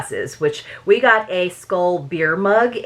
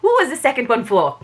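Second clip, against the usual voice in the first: put-on higher-pitched light French accent; attempted French accent; 5 semitones higher, faster than usual. Reader rehearsing the detective's question, attempted French accent. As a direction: repeat it in a practised French accent. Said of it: "than I can do a French accent".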